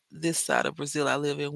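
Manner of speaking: ordinary talk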